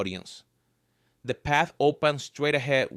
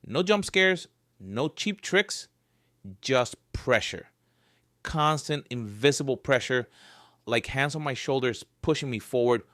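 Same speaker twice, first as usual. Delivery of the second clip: ordinary delivery, nothing marked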